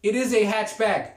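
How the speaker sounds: usual speaking voice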